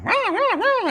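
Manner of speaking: shrieking bird cries at the dog; bird squawk. Retold or imitated. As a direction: squawk back like an angry bird. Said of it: "the bird's going".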